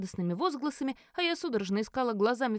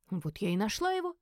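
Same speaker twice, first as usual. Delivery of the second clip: usual speaking voice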